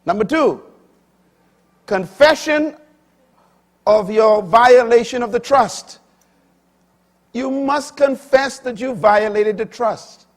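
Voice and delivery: high, loud pulpit delivery; full-force sermon voice; fiery preaching. Acted. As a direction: preach it out to the congregation.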